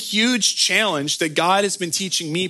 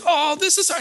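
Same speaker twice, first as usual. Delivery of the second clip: whiny complaint; 11 semitones higher than usual. Retold or imitated. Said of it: "And I was like".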